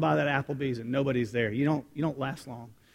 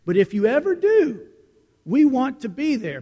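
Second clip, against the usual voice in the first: loud, higher eager urging; eager waiters; 7 semitones higher, 6 dB louder than usual. Retold or imitated. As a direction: call it out like teasing waiters. Waiters urging him, retold.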